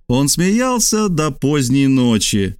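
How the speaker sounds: usual speaking voice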